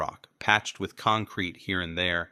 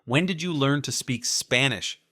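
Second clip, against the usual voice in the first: shouted question; 6 semitones higher than usual. A narrator's reading. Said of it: "Peter shouted behind me".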